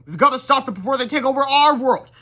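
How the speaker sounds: high, loud determined call; rally to stop them; urgent vow. Acted. George urging friends to stop villains, loud urgent vow.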